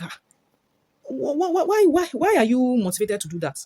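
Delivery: stammering, high-pitched incredulous why; baffled disbelief. Retold or imitated. Reviewer reacting to people lying on camera, baffled disbelief. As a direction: stumble over the question in high disbelief. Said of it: "I just sit down and I'm like".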